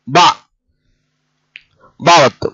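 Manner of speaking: ordinary talk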